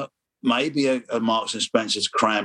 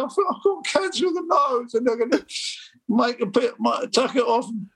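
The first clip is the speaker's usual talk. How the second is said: put-on much higher, tearful little boy; crying child voice; 11 semitones higher than usual. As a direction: whimper it like a scared child. Child self crying to mum.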